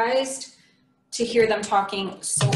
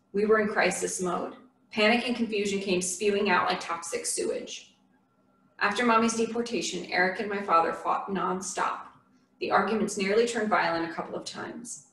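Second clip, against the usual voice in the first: plain reading voice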